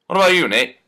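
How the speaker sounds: ordinary talk, nothing marked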